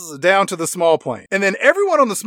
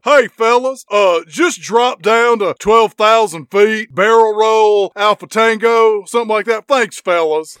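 hammed-up agent, louder and slow, half shouting; mock agent orders; 4 semitones higher, 5 dB louder than usual. Agent ordering the pilot and co-pilot, hammed-up slow half shout. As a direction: bark casual orders at the cockpit like a cocky hero. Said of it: "He tells the pilot and co-pilot".

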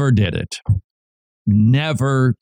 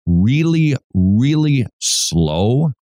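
drawn out slowly; slower than usual. Real, not acted. Preaching, acting out slowness.

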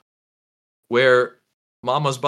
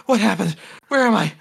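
startled mother waking; 7 semitones higher, faster than usual. Acted. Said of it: "she sits bolt upright with a jolt".

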